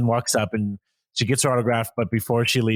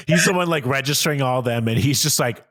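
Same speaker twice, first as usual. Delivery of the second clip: ordinary talk, nothing marked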